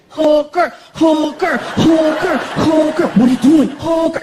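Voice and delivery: put-on loud, high chanting little boy; kid throwing a fit. Son having a tantrum in the kitchen, loud high chant. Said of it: "He throws a fit".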